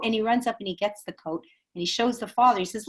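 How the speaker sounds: normal talking voice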